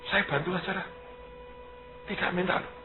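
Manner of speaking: high, soft, changed-heart official; an official, now softened, quietly offering help; mock official voice. Imitating official changing mind.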